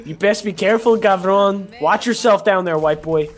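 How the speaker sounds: hammed-up Chicano warning. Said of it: "I lost the accent a bit".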